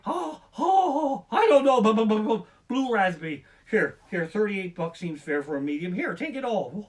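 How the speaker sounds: high, loud flustered stammering; hammed-up frightened rambling; mock panic stammer. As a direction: babble it like a terrified customer.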